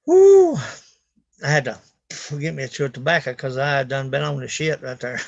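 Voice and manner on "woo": loud high whoop. Real, not acted. Whooping in the truck, loud and high. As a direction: let out a happy holler.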